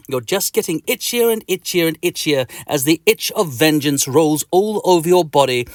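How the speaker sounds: plain reading voice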